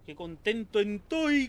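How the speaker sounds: loud, slow quoted cheer; Macario quote. Retold or imitated. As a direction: shout it like Macario. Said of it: "como diría Macario". (gritar despacio como Macario)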